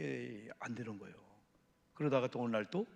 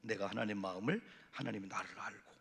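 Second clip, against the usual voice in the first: ordinary delivery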